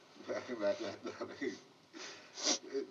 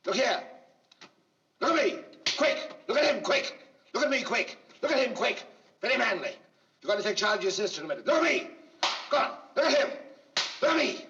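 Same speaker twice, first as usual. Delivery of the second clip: shouted cues; 7 semitones higher, 5 dB louder than usual. Real, not acted. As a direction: shout cues across the set. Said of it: "director shouts cues during take".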